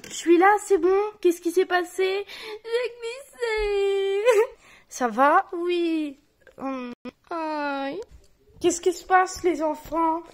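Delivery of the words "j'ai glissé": tearful. Acted.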